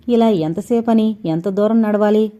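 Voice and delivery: ordinary delivery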